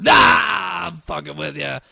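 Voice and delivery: loud, high burst that eases off; joking outburst. Retold or imitated. Acting out a man pointing a gun as a prank, joking shout. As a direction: burst out, then let it drop.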